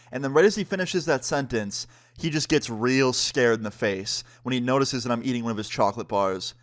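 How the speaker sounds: ordinary talk, nothing marked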